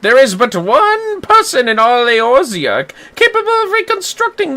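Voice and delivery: high, grand, theatrical gentleman; pompous inspector voice. Acted. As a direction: play him like a puffed-up detective. Voices Hildebrand in game.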